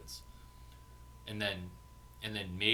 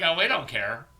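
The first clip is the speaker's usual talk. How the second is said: loud mock dismissal; 7 semitones higher, 12 dB louder than usual. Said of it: "They're just like".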